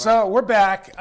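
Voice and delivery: slow, loud host announcement; drawn-out loud welcome back; show is back. Acted. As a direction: draw it out like opening a show. Show reopening after the break.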